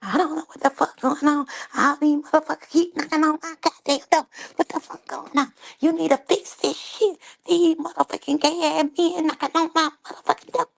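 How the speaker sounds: high, fast mock mom rant; Tim's mom ranting. Mom fuming at strangers knocking on her door, high fast mock rant. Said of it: "'cause she was like".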